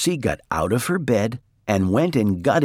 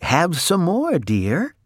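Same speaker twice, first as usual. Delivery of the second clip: high, coaxing offer, lightly acted; lightly voiced sleep-talking wife; 9 semitones higher, slower than usual. A narrator's reading. Missus Watson buttering toast in her dream, lightly voiced coaxing. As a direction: gently urge more food, starting high. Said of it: "Missus Watson said".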